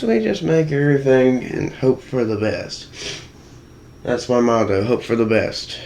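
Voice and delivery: usual speaking voice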